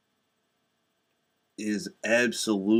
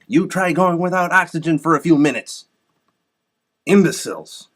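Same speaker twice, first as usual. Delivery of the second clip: real exasperated rant, louder and higher; indignant challenge; 5 semitones higher, 5 dB louder than usual. Real, not acted. Conservative scolding environmentalist imbeciles, indignant and loud. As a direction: throw an angry challenge at your opponents.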